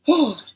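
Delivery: loud, high exhaled sigh; exasperated sigh. Real, not acted. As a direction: heave a big sigh. Exasperated after describing his body.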